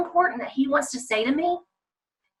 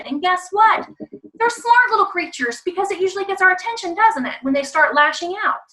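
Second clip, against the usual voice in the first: higher, fast excited tease; animated aside; 7 semitones higher than usual. Real, not acted. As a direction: rise up and tease the audience. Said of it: "lively aside to audience".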